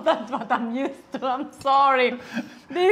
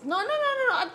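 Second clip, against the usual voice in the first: high-pitched no; 13 semitones higher, 3 dB louder, faster than usual. Real, not acted. Stopping him adding more pepper.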